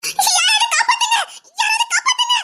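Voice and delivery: high, frantic shouts for help; cry for help. Acted. Girl surrounded by thugs calls for help, frantic high shout. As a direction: scream for rescue, tearful.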